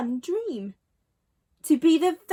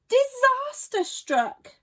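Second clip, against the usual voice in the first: dramatic high and slow; 10 semitones higher, slower than usual. A narrator's reading. Dramatic narration.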